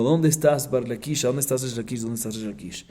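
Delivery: ordinary talk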